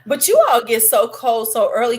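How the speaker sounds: usual speaking voice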